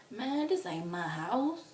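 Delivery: drawn-out groggy complaint; sleepy protest. Retold or imitated. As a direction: whine it slowly, just woken up. Acting out groggy refusal to get up.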